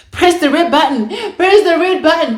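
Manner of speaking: loud, high, panicked yell, acted; urgent shout. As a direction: order it frantically, as if pushing. Urgent order to husband, retold.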